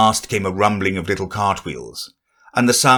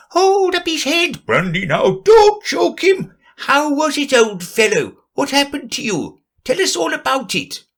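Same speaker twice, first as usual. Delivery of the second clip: high, fussing little animal voices. Acted. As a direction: crowd tending to Bill. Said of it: "another confusion of voices".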